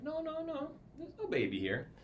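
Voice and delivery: mother hiding her baby, hammed-up denial; mock denial. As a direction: deny it with put-on innocence. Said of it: "She's like".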